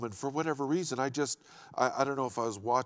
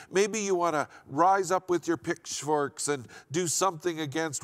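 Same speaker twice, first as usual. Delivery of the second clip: raised, driving pulpit voice; preaching; 3 semitones higher, 4 dB louder than usual. Acted. Pastor urging the congregation to hear, full preaching voice.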